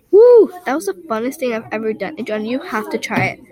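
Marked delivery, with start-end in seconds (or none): 0.1-0.5 high loud whoop down the slide; excited whoop. Acted. Kid whooping down the water slide, thrilled. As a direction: cheer as you whoosh down the slide.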